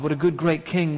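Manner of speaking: ordinary talk, nothing marked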